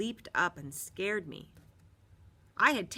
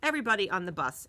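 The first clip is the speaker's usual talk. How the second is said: ordinary delivery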